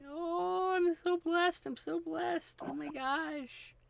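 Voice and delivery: very high squeaky pet voice; voicing the cat. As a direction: speak for the cat, high and thrilled. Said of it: "She's saying".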